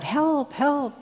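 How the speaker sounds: high, desperate cries for help; yelling for help. Retold or imitated. Man dangling from a root yells for help, high and desperate. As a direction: call out for rescue from the cliff. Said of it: "yells out".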